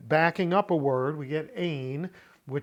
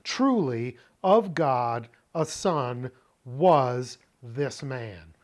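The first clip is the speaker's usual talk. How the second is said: slow, deliberate, spaced-out phrases; slow, emphatic literal rendering; literal word-by-word reading; 2 semitones lower, 5 dB louder, slower than usual. Shown as a demonstration. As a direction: lay it out piece by piece, firmly. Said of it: "this phrase literally".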